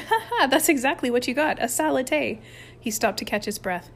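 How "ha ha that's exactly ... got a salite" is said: high, gleeful teasing; amused, pitched-up mockery; laughing. A narrator's reading. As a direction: rub it in through laughter. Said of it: "Pierre laughed out loud".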